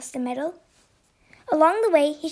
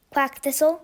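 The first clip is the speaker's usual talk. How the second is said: plain reading voice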